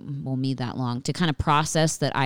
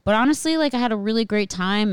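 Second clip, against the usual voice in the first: usual speaking voice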